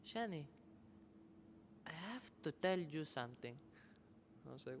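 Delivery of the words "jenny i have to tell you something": put-on Argentine accent. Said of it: "she has, like, this super thick accent".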